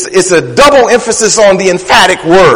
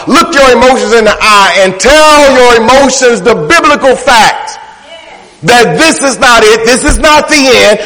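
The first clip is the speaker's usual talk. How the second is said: fiery preaching; 4 semitones higher than usual. Acted. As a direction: preach it like a fighter coming back. Preaching builds to climax.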